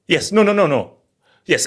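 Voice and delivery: normal talking voice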